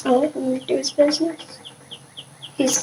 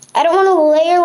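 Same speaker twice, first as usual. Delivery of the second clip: ordinary talk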